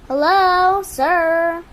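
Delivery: loud greeting. Real, not acted. Greeting the bird.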